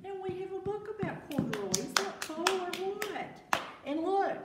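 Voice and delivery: high, loud and thrilled, showing off the book; excited show-and-tell. Real, not acted. Teacher showing the class the Corduroy book, excited and high.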